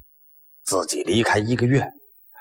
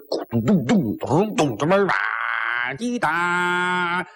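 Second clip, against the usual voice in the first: imitated drums; 5 semitones higher, slower than usual. Storyteller imitating the drums at the north gate. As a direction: mouth drum roll and a high cymbal tap.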